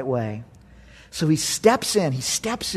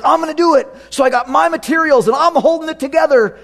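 higher, boastful voice of a self-sure builder; proud man; 9 semitones higher, 4 dB louder than usual. Acted. Preacher voicing man boasting about his own work, high and cocky. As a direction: play the cocky builder bragging. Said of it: "Man's like".

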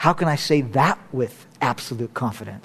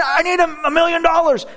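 mock shouted prayer; 13 semitones higher than usual. Pastor mimics a greedy believer's shouted plea. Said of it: "If you're praying".

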